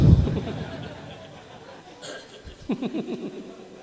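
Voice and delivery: breathy high laughter. Real, not acted. Preacher laughs at his own slip, real laughter. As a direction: break into laughter.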